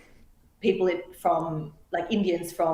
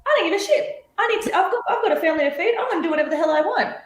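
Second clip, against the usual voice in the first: much higher, brash don't-care rant with a laugh; mock defiant migrant; 12 semitones higher, much faster than usual. A woman mimicking desperate migrants justifying themselves, brash and defiant. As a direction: toss it off like someone who doesn't give a damn. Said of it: "They're like".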